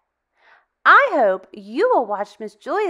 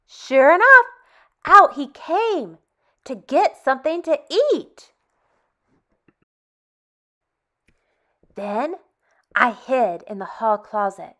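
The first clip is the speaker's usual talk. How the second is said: put-on reading to children; 4 dB louder than usual. Teacher reading a picture book to kids, lively and expressive. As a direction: tell it like a bedtime story to little ones.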